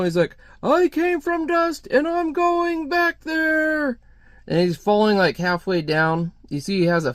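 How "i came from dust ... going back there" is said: high, slow, sustained wail; hammed-up fanatic proclaiming as he leaps; crazed intruder. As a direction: chant it out on one high note, stretched. Crazed intruder proclaims while jumping.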